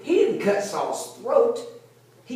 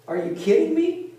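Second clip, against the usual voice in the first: disbelief; 9 semitones higher than usual. Acted. Mock disbelief at David.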